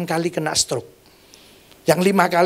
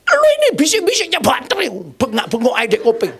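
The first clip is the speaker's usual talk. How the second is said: much higher mock old mother's voice; mother's teasing scold; 7 semitones higher than usual. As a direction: tease him like a mother scolding. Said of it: "Terus ngomong gini". (suara ibu yang menegur sambil bercanda, jauh lebih tinggi)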